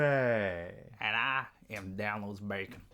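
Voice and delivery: slow put-on hillbilly accent. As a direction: drawl the name in hillbilly accent. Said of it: "try my hillbilly voice".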